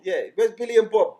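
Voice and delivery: loud, high-pitched challenge; acted indignant demand. Customer finding two strangers painting the house, acted loud demand. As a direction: demand it loudly, pitch jumping up.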